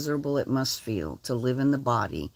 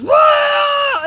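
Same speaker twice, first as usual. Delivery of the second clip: high, loud imitated shriek; imitated scream; 12 dB louder than usual. Imitating Dee's scream when the dogs struck, high and loud. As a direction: shriek it the way Dee did. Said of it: "all I heard was".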